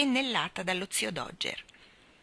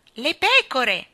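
loud, raised calling-out; shouted call; 6 semitones higher, 8 dB louder, slower than usual. A narrator's reading. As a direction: call out the next job loudly. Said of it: "gridò Nelly Rubina mentre scendeva". (richiamo gridato)

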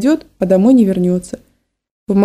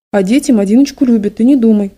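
usual speaking voice

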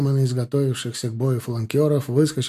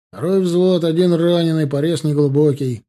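loud, higher military roll-call report; raised parade-ground voice; shouted report; 4 semitones higher, 7 dB louder than usual. A narrator's reading. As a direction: call the casualties out to the captain. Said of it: "крикнул подпоручик Чагин".

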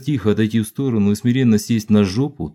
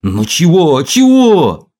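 high, loud exasperated shout; annoyed shout; 7 semitones higher, 8 dB louder, slower than usual. A narrator's reading. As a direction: snap back loudly. Irritated shout back.

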